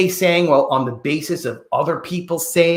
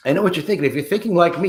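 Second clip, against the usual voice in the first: ordinary talk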